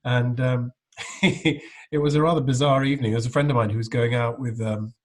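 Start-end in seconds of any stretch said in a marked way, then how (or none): none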